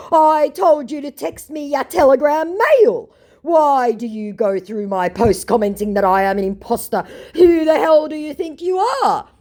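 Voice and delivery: high, loud, hammed-up rant; put-on outraged scammer, voice cracking at the end; mock furious scammer. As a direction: rant like an offended fake star. Scammer's angry message read aloud.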